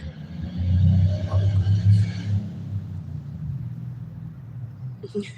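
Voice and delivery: long wheezing laugh, low and airless; breathy laugh. Real, not acted. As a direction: let it out as a silent shaking laugh. Laughs after mocking the celebrities.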